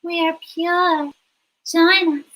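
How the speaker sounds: high, slow creepy doll voice; eerie little anime doll beckoning. Acted. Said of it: "sounds like a little doll in an anime".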